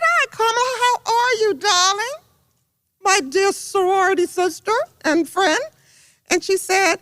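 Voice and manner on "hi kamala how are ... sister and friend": high-pitched, gushing retold hello; effusive greeting. Congresswoman greeting her sorority sister by phone, high effusive re-enactment. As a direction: greet a dear friend with high delight.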